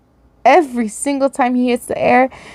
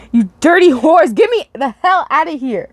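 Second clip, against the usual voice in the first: corrected take; 6 semitones higher than usual. Acted. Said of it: "I gotta read this".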